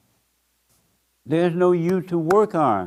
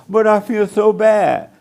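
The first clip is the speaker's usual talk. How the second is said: higher, louder, slow mock lament; mock guilt-ridden whine; 7 semitones higher, 7 dB louder, slower than usual. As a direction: ham up fake sorrow. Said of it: "That's all ego".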